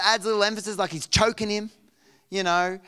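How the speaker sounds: normal talking voice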